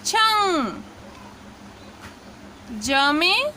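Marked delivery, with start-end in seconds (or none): 0.0-0.8 छंग said clearly with the nasal ng; teaching demo. Shown as a demonstration. Teacher showing students how to say छंग. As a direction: model छंग for learners. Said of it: "तो उच्चारण होगा".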